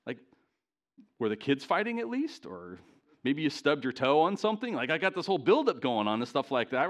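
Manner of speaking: ordinary talk, nothing marked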